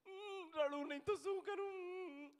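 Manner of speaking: high, drawn-out comic wail; mock crying. Weeping woman answers the man asking why, mock crying. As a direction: whine it slowly like a weeping woman. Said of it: "આ બહેનોનો સૂર છે રડવાનો".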